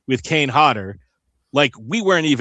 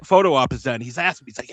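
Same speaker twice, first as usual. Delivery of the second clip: normal talking voice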